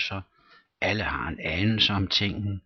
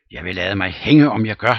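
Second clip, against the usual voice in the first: emphatic denial; 9 dB louder than usual. Acted.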